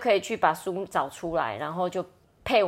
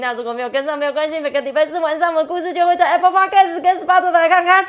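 high, loud, rushed outro in one breath; podcast sign-off; 10 semitones higher, 13 dB louder than usual. Acted. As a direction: announce it all without breathing. Closing promo in one breath.

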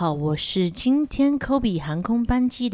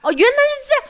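mock realization; much faster than usual. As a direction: feign sudden understanding. (夸张的恍然大悟)

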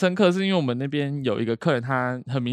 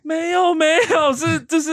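flustered clerk; 4 dB louder, slower than usual. Retold or imitated. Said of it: "我说".

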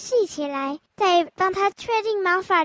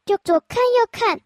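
ordinary delivery

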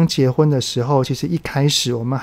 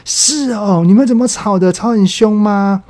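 put-on higher, eager voice of a nosy kid; retold son, higher and keen to hear more; excited curiosity; 7 semitones higher, 5 dB louder than usual. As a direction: imitate the son thrilled by gossip, slowing on the last question. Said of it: "一副非常的惊奇的兴奋样".

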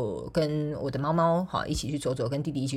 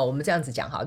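ordinary talk

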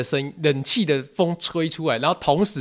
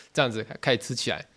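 normal talking voice

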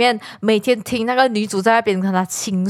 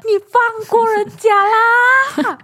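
high, drawn-out plea, louder; exasperated plea; 12 semitones higher, 5 dB louder, much slower than usual. Real, not acted. Friend scolding the male lead from the drama, drawn-out plea. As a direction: stretch it out, begging him to stop.

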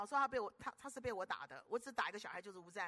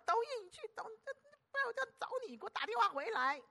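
high, scolding mother on the phone; hammed-up scolding of a son who went out; mock angry mother; 12 semitones higher, 4 dB louder, faster than usual. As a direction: nag him like a clingy mom.

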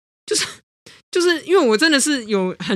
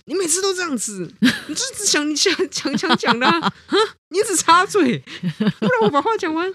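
much higher, whiny reproach; mock exasperated complaint; 10 semitones higher than usual. Partner griping about constant interruptions, mock exasperated. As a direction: complain like a partner who keeps getting cut off. Retold quarrel, acted complaint.

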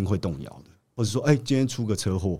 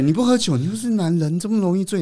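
mocking taunt; 10 semitones higher than usual.

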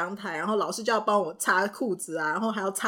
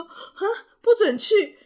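slow, higher whine half-laughing; laughing through a mock cry, slow and higher; mock despair; 11 semitones higher, 6 dB louder, slower than usual. Retelling child's despair.

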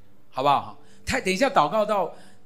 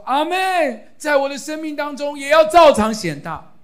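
emphatic echo prayer; 9 semitones higher, 8 dB louder than usual. Shown as a demonstration. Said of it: "他讲完，你就说".

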